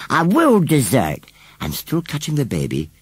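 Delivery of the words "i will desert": slow, high, loud threat; temper. A narrator's reading. Scarecrow threatens to abandon baby, angrily. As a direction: stress it as an offended vow. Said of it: "went pale green with temper".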